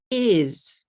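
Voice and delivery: "Is" said clearly as one syllable, repeated; slow clear pronunciation model; careful demo. Shown as a demonstration. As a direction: model "Is" in one clean beat for learners. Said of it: "one, one sound".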